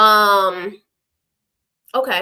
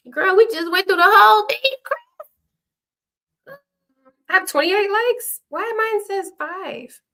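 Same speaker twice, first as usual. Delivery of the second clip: surprised; 10 semitones higher, 7 dB louder than usual. Real, not acted. Surprised at like count.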